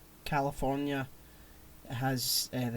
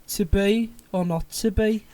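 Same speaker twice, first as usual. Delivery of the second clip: put-on louder, higher Birmingham accent; Brummie Shakespeare; 6 semitones higher, 5 dB louder than usual. Trying out how Shakespeare might have sounded, in Brummie. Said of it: "might have had a Birmingham accent".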